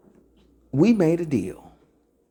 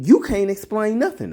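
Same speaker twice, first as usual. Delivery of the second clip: usual speaking voice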